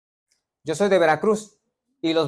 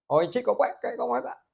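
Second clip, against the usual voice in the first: put-on playful, higher Veracruz coastal accent; 7 semitones higher, 5 dB quieter than usual. Preacher teasing a Cuban congregant, in a Veracruz accent. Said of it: "Habla como lo de Veracruz".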